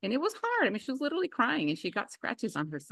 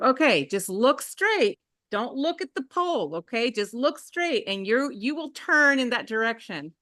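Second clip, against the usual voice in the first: put-on steady and careful, talking a child through a turn; parent coaching; 5 semitones higher, 4 dB louder than usual. Parent coaching daughter on bike.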